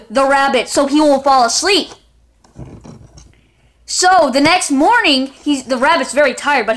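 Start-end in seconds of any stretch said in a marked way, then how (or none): none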